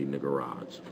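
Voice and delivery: usual speaking voice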